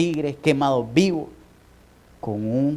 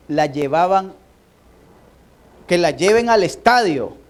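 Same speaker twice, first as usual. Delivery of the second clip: louder, higher sermon voice building up; fiery preaching; 6 semitones higher, 8 dB louder than usual. Acted. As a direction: preach with rising fervor. Preaching rises to full force. (predicación fervorosa y cada vez más fuerte)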